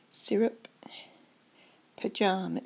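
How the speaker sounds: normal talking voice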